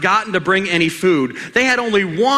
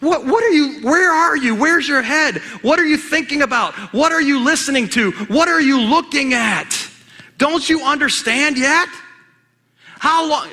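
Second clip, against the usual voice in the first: higher, exasperated rapid questions; frustrated Jesus; 6 semitones higher than usual. Acted. Jesus grilling distracted disciples, frustrated. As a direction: pepper them with fed-up questions. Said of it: "he was a little bit frustrated".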